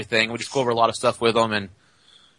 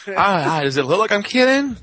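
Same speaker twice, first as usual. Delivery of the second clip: put-on goofy drunk; 7 semitones higher, 3 dB louder than usual. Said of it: "goofy drunk look on his face".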